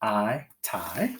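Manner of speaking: tie with a long I, like "eye". Shown as a demonstration. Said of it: "Let's read it with long I".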